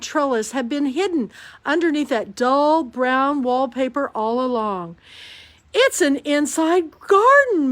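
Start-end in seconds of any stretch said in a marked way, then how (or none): 5.7-7.7 marveling. A narrator's reading. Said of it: "Mia marveled".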